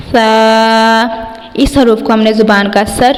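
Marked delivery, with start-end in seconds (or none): 0.1-1.0 سا with the tongue tip slightly out between the teeth; tajweed letter demo. Shown as a demonstration. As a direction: put the tongue out a little for سا. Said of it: "زبان کو دانتوں سے تھوڑا سا باہر نکال کر". (سا زبان تھوڑا باہر نکال کر)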